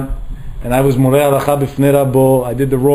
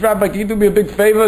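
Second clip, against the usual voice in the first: put-on higher, pleading little girl asking a favor; six-year-old begging the rabbi, higher childlike voice; 7 semitones higher than usual. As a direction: plead up high like a small child.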